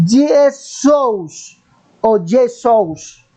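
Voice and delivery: Jesús dicho a la griega, como "Iesús", muy lento; pronunciación griega. Shown as a demonstration. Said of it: "el nombre del Señor quedó plasmado en griego". (Jesús pronunciado a la griega, muy despacio)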